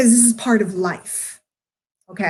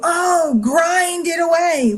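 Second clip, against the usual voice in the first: higher, drawn-out mock cheer of hustle advice; mocking pep talk; 6 semitones higher, much slower than usual. Mimicking the hustle crowd, mock cheer. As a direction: spit back what everyone says, all hype. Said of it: "everybody would say to you".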